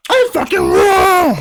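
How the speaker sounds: very high, drawn-out yell; hammed-up kitchen scream; mock chef scream. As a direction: outdo an outraged chef, stretching the end. Topping the other's chef impression.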